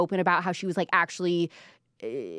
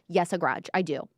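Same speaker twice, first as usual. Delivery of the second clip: ordinary delivery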